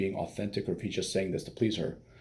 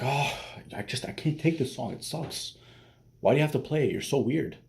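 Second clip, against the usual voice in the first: higher-pitched imitation of a bitchy girlfriend; exasperated whining; 6 semitones higher than usual. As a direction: whine in mock exasperation. Acting out girlfriend complaining about song.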